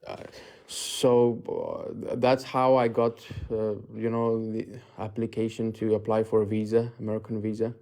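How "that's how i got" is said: usual speaking voice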